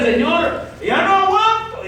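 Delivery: high, drawn-out cry to God; wailing a remembered prayer; retold tearful plea. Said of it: "Y lloraba a moco caído".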